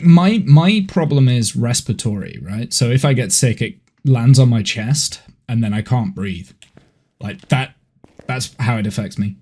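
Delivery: ordinary talk, nothing marked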